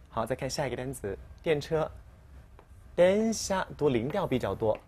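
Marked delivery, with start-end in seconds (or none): none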